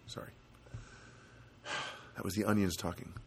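ordinary delivery

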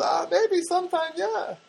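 very high, coy mock girl voice; put-on high-pitched girlish maybe; young Armenian girl. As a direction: imitate a girl hesitantly agreeing. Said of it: "she, she pauses".

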